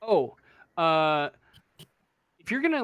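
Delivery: ordinary delivery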